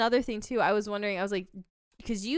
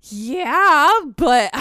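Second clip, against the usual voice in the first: much louder, a blurted yeah; blunt mock admission; 7 semitones higher, 13 dB louder than usual. Husband admits fantasizing.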